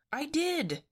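high, surprised protest. A narrator's reading. Fred defending himself to Daphne, surprised. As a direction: protest with the pitch leaping up. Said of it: "Fred said in surprise".

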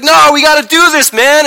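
very high, loud insistence; fighting yell. Retold or imitated. Jesus arguing with John at the river, yelling. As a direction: shout it mid-scuffle. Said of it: "I just told you they were fighting".

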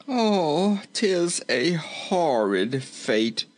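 high, drawn-out woeful moan. A narrator's reading. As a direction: slowly bemoan your fate in character. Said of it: "moaned Rinkitink".

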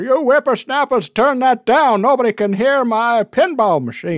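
put-on high, cranky old-timer voice; hammed-up grumpy old man yelling; old prospector. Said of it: "like, angry Sam Stern coming over?".

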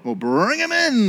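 high, drawn-out royal command; king's order. Acted. King ordering Haman brought into court, commanding. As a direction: stretch it out as a hammed-up summons. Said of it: "the king ordered".

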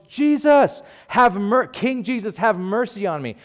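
high, loud cry for mercy; imitated yelling. Blind beggar yelling at Jesus over the crowd, imitated yell. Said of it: "yelling at the top of his lungs".

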